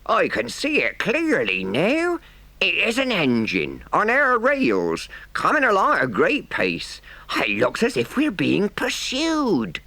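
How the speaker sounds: high-pitched engine driver calling out; slow, urgent call in a rustic accent. A narrator's reading. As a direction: lookout reporting a chase. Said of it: "Presently he called out".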